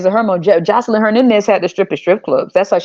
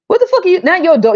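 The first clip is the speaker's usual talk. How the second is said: outraged outburst; 9 semitones higher, faster than usual. Real, not acted.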